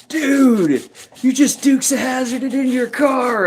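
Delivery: high, far-off surfer-dude voice, stunned; amazed teen dude. Retold or imitated. Teenager gawking at the narrator's car entrance, high surfer-dude voice.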